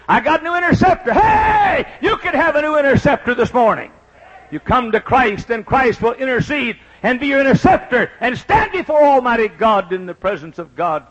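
high, loud shouted proclamation; full-force preaching. Acted. Preacher offering a new interceptor to listeners, full-force preaching. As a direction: shout it out to the whole congregation.

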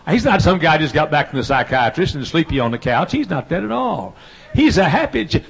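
fervent preacher building up; preaching at full force. Acted. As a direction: build the sermon up toward the shout.

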